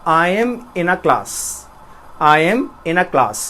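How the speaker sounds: slow British accent, a as in father. Shown as a demonstration. Teacher demonstrating the British accent to students, slow and careful. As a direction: say it the British way, slowly. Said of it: "अगर मैं British में बोलूँगा तो बोलूँगा".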